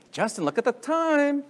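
high, playful put-on voice; mock congregant exclamation. Pastor voicing a relieved congregation about the time, high and playful.

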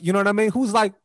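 usual speaking voice

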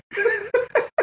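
short high laugh; real high laugh. Real, not acted. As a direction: burst out laughing. Laughing at Padre name.

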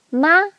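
"Ma" held high and level; high-level first tone. Shown as a demonstration. Teacher showing the first tone, "Ma" high and level. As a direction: say "Ma" on one high, unchanging pitch. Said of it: "first tone is a high-level tone".

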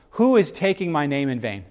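normal talking voice

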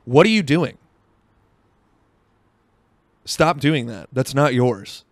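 upset. Retold or imitated. Brother scolds him over a stolen fry, retold upset. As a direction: sharply imitate an upset telling-off. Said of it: "he's, like, upset about it".

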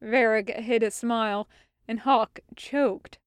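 usual speaking voice

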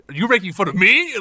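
loud, high challenge; acted indignation. Offended hero confronting his mockers, loudly indignant. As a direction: burst out in mock-heroic affront. Hero angered before stomping.

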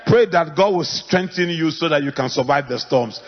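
ordinary delivery

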